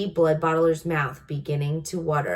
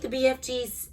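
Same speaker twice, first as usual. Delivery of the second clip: ordinary delivery, nothing marked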